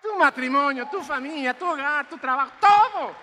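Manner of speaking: rising to a loud preaching shout; fervent preacher shout; building shout. Real, not acted.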